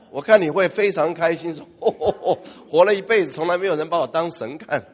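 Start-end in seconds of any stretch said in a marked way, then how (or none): 1.8-4.8 laughing oh oh oh, giddy and pleased; a believer flattered to be treated as a god, giggling; flattered glee. Acted. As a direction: revel in being called a god.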